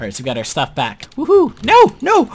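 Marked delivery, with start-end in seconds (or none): 1.2-2.3 high shrieking no; panicked yelling. Real, not acted. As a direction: yell in sudden panic. Celebrating then being hit.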